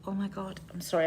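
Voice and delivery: ordinary talk, nothing marked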